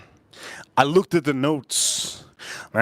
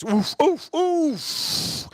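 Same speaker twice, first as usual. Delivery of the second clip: oof; 11 semitones higher, much slower than usual. Real, not acted. Reacts to the smell.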